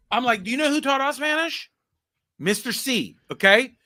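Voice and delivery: usual speaking voice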